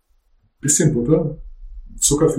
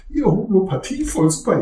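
high, gushing mock enthusiasm; mocking believer voice; 9 semitones higher than usual. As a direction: parody a naive fan.

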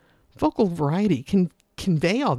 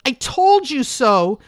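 put-on loud, high, slow teen yell; a teenager shouting it back; a teen yelling in triumph; 7 semitones higher, 7 dB louder, slower than usual. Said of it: "a teen's voice may be yelling".

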